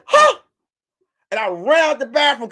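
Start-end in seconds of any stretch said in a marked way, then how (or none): 0.0-0.4 sudden high yelp of surprise; startled huh. Retold or imitated. Caught in the bathroom, a startled high huh. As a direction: jump up high and loud on huh. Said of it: "And I said".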